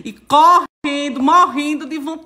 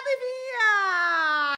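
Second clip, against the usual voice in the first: high, softer, very slow Adivinha; drawn-out tease; 8 semitones higher, 7 dB quieter, much slower than usual. Real, not acted. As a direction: stretch it out, softer and teasing. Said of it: "quem acabou de chegar da escola foi quem?". (adivinha bem arrastado e mais baixinho)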